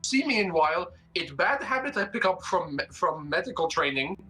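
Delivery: put-on high, accented nervous voice; nervous stress eater; high character voice. Character voice, eating.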